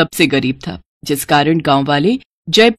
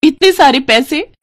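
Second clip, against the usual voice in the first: high-pitched, thrilled wife; delighted amazement; 6 semitones higher than usual. Acted. Wife sees all the money, delighted amazement. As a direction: marvel at a sudden fortune.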